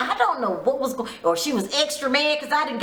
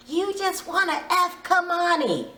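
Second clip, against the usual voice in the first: mock accusation; 8 semitones higher than usual. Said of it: "she says to Agu".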